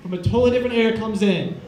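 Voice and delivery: usual speaking voice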